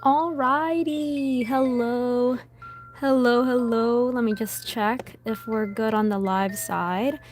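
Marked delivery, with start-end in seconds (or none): none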